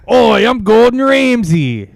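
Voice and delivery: loud, high chef impression with an Australian twang; Gordon Ramsay. Co-host shows off his chef impression, shouted high and sounding Australian. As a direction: holler a bad celebrity-chef impression, slowly. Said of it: "Have you ever heard my Gordon Ramsay".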